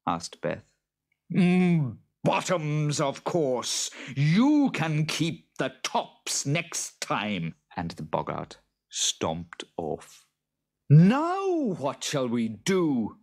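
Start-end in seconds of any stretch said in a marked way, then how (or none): 1.3-7.5 high, slow creature voice; hum, then choose with drawn-out certainty; smug boggart. A narrator's reading. 10.9-13.2 high, loud, drawn-out question; worried farmer. A narrator's reading. Farmer frets to his wife.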